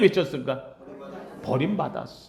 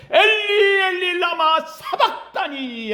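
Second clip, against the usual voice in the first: loudest cry; 12 semitones higher, slower than usual. Retold or imitated. Pastor voicing Jesus's loudest cry to God, high shout. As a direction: cry it out to heaven at full voice. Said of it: "가장 큰 소리로 하나님 앞에 외치는 소리".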